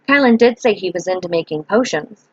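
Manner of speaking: plain reading voice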